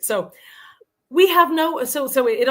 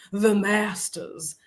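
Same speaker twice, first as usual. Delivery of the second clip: slow imitated Southern drawl; mock Southern accent, leisurely and drawn out; slower than usual. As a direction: stretch it out like the Southern older lady. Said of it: "I have to say".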